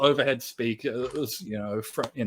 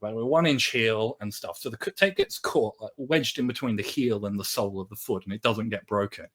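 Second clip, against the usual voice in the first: ordinary delivery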